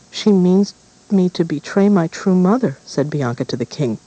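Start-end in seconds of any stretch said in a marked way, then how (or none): 0.1-2.8 put-on resentful girl. Bianca complaining to king.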